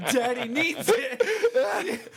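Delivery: slow, high, laughing mock plea. Teasing a friend, a slow high laughing plea. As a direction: stretch it out high like a giggling beggar. Repeated higher, slower, laughing.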